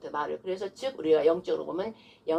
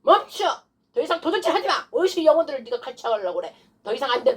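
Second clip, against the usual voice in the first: high shouted orders, easing toward the end; loud demonstrated rebuke of the devil; forceful command; 6 semitones higher, 4 dB louder than usual. Shown as a demonstration. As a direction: halt the thief with authority. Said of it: "이렇게 막 나가야 돼".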